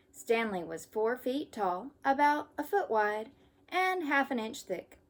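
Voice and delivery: lively storybook read-aloud; reading to children. Acted. Teacher reading a camping story to kids. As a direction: read it brightly for young listeners. Reads picture book to children.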